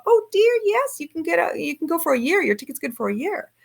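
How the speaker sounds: a hint of a sweet flight attendant reassuring her; chirpy stewardess. Flight attendant cheerfully reassures passenger about ticket, lightly voiced high. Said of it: "she's all".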